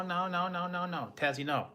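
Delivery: ordinary delivery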